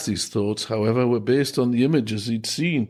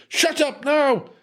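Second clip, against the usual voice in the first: furious shout; 13 semitones higher, slower than usual. Acted. Headmaster losing control of room.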